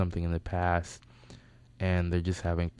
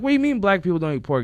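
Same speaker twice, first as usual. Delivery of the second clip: fast, high, loud challenge; mock indignation; 12 semitones higher, 7 dB louder, faster than usual. Guest challenges host over pork, mock indignant. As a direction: fire it back high and quick. Teller challenges friend's claim.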